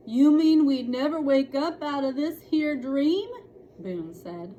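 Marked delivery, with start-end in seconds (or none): 0.1-3.3 cowboy drawl. Acted. Cowboy Boone speaking.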